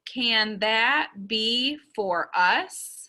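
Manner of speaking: slow, one beat per word; counting out words. Shown as a demonstration. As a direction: say it slowly as if counting each word. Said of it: "count on my fingers".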